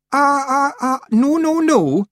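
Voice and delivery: high, loud, agitated stammer; flustered protest. A narrator's reading. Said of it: "jumping up and down".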